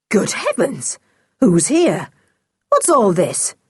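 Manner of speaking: startled man caught off guard; astonished exclamation. A narrator's reading. Smuggler stumbling on huddled children, astonished. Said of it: "a loud exclamation of astonishment".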